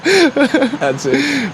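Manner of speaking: squeaky laughing words. Real, not acted. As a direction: speak through giggles.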